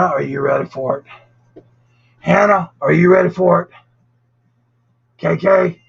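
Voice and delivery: ordinary talk, nothing marked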